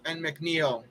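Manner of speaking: lively baseball play-by-play; play-by-play call. Acted. Announcing his ballgame to friends, live broadcaster style. Commentates his video game.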